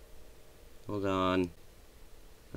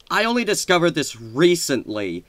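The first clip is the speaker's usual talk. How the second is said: ordinary talk, nothing marked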